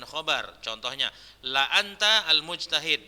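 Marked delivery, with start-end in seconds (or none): 1.5-2.3 "la anta" with a glottal break between the two a sounds; careful "la anta", hamza sounded; hamza pronounced. Shown as a demonstration. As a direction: keep la apart from anta. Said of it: "Jadi nggak boleh dibaca".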